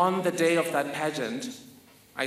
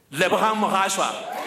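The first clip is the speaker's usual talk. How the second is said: announcer call; 3 semitones higher, 4 dB louder than usual. Retold or imitated. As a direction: call the name like an emcee. Announcing the winner's name.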